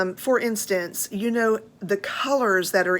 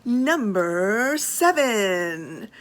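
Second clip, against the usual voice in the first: announcer; much slower than usual. Real, not acted. Announcing next bag number.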